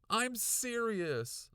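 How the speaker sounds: high, loud, slow insistence; insisting. A narrator's reading. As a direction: insist with weight. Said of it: "I insisted".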